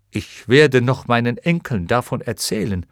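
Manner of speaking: plain reading voice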